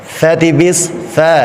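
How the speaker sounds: FA light, with a flat "æ" vowel; thin letter. Shown as a demonstration. Teacher drilling the alphabet, thin Fa.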